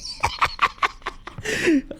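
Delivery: high wheezing laugh; laughter. Real, not acted. Gamer cracking up mid-game, wheezy laughter. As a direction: wheeze with laughter, mostly breath.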